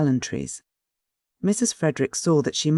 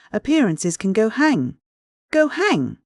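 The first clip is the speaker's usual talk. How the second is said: rising, higher and louder defiant cry; defiant outburst; 8 semitones higher, 3 dB louder than usual. Acted. Aunt relays the daughter's defiance, louder and higher outburst. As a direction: fling it out, go hang.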